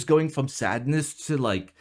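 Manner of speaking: usual speaking voice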